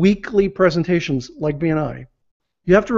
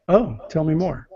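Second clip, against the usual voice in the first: flat, falling, puzzled "Oh, tell me more"; confused tell me more; 4 dB quieter than usual. Shown as a demonstration. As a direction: sound lost and unsure, "oh" dropping down. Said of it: "same words, but different inflection with the voice".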